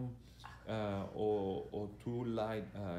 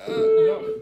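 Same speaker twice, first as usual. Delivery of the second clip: mock disappointment; 19 dB louder than usual. Real, not acted.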